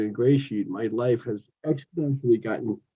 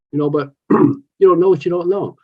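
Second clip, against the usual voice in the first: ordinary delivery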